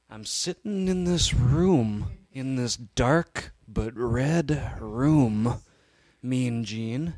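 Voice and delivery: Macho Man. Acted. Player telling Mean Gene about the room, building wrestler impression. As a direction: start measured and drawn out, swell at the end. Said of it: "we're gonna do this Macho Man cast".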